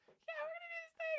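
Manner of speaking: put-on very high squeaky kid voice; mock your own voice before it dropped, way up high; imitating unbroken boy's voice. Eighth grader on a class video, imitated squeaky boy voice. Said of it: "my voice hadn't dropped yet".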